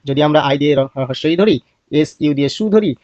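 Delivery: ordinary delivery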